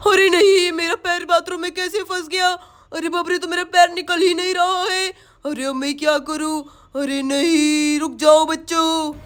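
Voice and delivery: low, worried witch. Acted. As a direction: fret as the trapped witch. Witch stuck, panicking. (फँसी हुई चुड़ैल की घबराहट)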